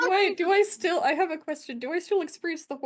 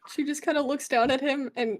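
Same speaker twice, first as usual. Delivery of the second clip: ordinary talk, nothing marked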